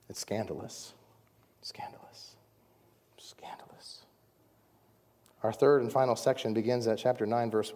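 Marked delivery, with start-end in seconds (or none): 0.1-0.9 hushed. Real, not acted. Repeated word fading to whisper. 1.6-4.0 whisper. Real, not acted. Repeated word fading to whisper.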